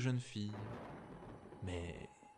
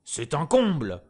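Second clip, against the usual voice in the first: emperor shouting, much louder; outraged shout; 5 semitones higher, 10 dB louder than usual. Acted. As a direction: cry it out in fury. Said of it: "s'écria l'empereur".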